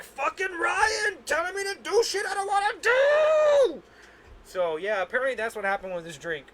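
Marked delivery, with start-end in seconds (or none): 0.0-3.8 angry rant. Retold or imitated. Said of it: "They were like".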